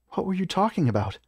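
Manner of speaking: high-pitched character voice; small companion's high, curious question. A narrator's reading. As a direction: ask it in a high, inquisitive voice. Clara questions the narrator.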